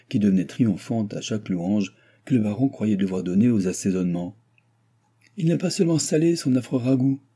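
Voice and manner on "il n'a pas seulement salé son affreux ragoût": a sharp, higher exclamation about the bland stew; exclaiming. A narrator's reading. Baron complaining to his guest about the stew, exclaiming higher. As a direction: cry out in mock outrage at the cook. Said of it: "s'écria le baron".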